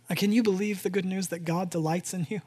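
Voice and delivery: ordinary talk, nothing marked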